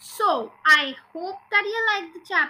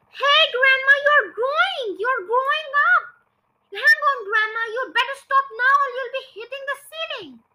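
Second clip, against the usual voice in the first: high, loud shouted warning; alarmed shout; 9 semitones higher, 5 dB louder than usual. A narrator's reading. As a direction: call out urgently to Grandma. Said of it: "gave a jump and shouted".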